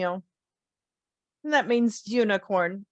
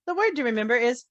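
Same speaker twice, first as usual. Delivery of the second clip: ordinary delivery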